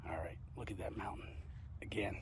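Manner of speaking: whisper. Real, not acted. Unvoiced whisper.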